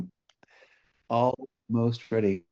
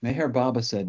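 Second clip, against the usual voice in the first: guided meditation; 2 semitones lower, 3 dB quieter than usual. Real, not acted. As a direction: ease listeners gently into stillness, leaving space between phrases.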